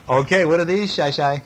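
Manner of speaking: plain reading voice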